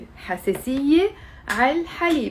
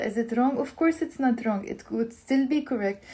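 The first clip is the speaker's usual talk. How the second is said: usual speaking voice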